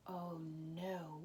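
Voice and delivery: whispered "Oh no" in dismay; whispered alarm. Acted. Soldiers seeing the king's spear gone, whispering in dismay. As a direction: breathe it out as a hushed whisper. Soldiers discover king unguarded.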